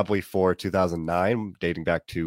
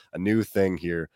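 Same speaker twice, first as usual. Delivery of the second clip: ordinary delivery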